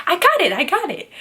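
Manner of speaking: quick, high-pitched brush-off; mock impatient reassurance. Surgeon to a picky patient, mock impatient reassurance. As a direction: imitate a surgeon cutting off instructions.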